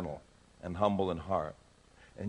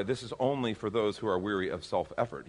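ordinary delivery

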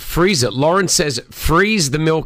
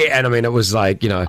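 usual speaking voice

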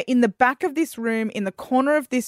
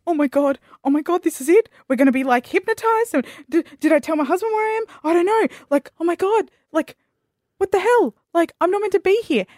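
high, rushed panic; panicking; 7 semitones higher, faster than usual. Retold or imitated. Guest fearing hypnosis, high retold panic. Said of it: "And I'm like".

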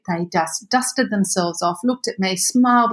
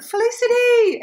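high call after someone; 14 semitones higher than usual. Retold or imitated. The shop girls calling her back, calling out. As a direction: call her name across the street.

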